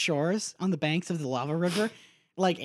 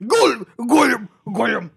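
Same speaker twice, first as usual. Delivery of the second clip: loud, high gulping Gollum noise; 5 semitones higher, 9 dB louder than usual. Acted. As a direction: cough it out like Gollum. Fake cough turned into Gollum.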